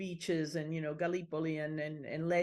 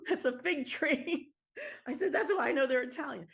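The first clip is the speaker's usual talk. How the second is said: high, laughing punch line; 9 semitones higher, 4 dB louder than usual. Real, not acted. Telling the realtor about the fig tree, laughing. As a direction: laugh through the words, pitched up.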